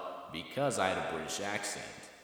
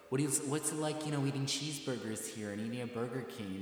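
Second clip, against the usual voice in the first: higher-pitched, lightly imitated curious questioner; curious locals; 6 semitones higher than usual. Locals asking the supposed Brit about fast food, mock curiosity. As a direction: lightly mimic someone marvelling at the foreigner. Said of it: "they're like".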